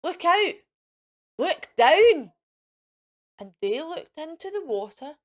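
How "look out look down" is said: higher, loud warning shout; urgent yell. Acted. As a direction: yell to warn them. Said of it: "who yelled".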